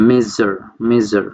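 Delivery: slow "মেজার" with a buzzing zh in the middle; demonstrated ZH word. Shown as a demonstration. Teacher giving an example word for zh, slow careful "মেজার". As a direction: say "মেজার" slowly, twice, showing the zh. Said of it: "অনেকটা এরকম".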